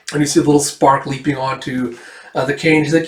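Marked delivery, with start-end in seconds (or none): none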